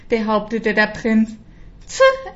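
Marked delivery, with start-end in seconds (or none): 1.8-2.3 a high, dismissive puff of air; scornful pfuh. A narrator's reading. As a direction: scoff it off. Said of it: "entgegnete die moderne Prinzessin".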